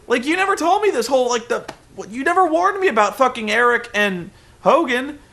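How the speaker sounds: shrill up into an indignant rant; mock Dixie rant. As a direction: Dixie scolding Sting, high mock rant. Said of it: "Dixie says".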